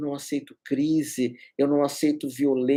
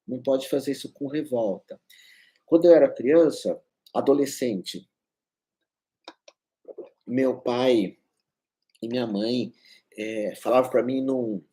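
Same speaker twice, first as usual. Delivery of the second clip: ordinary talk, nothing marked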